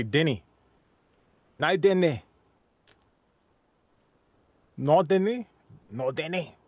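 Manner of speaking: "Denny" with a short e and ee ending; Scottish "didnae". Shown as a demonstration. Showing a learned word, "Denny" in Scottish pronunciation. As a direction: try out "No Denny" in Scots pronunciation. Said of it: "They say".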